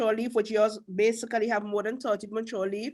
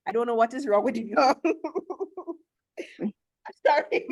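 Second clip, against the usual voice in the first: laughing through the words. Real, not acted. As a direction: chuckle while teasing. Host laughing at caller.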